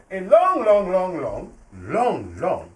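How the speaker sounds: descending drawn-out longs; falling repeated long. Acted. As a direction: let the longs fall away step by step. Storyteller stretching "long.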